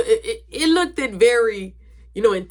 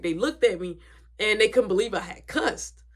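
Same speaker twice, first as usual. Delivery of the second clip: normal talking voice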